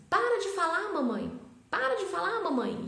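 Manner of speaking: put-on high, loud childish protest; hammed-up kid shouting at mom; imitating a child's outburst. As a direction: mimic a little girl telling her mom to stop. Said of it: "ela começou a falar assim".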